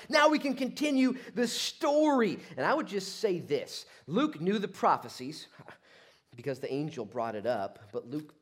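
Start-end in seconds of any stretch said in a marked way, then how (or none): 0.1-2.4 high, loud proclamation; triumphant announcement. Acted. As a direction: announce it at full volume. Voicing angel grabbing the mic.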